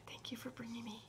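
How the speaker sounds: soft grateful whisper. Retold or imitated. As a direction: whisper your thanks in a theater. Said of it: "and I said".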